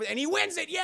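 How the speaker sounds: high, loud celebratory shout; excited cheer. Pretend. Streamer telling chat that Max wins, excited cheer. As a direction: cheer the winner home.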